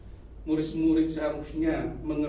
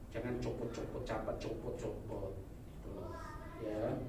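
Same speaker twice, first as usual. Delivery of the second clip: ordinary talk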